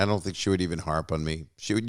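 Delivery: usual speaking voice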